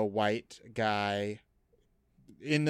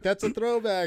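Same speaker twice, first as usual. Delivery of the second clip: mock amused reaction; 12 semitones higher, 7 dB louder than usual. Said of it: "You're like".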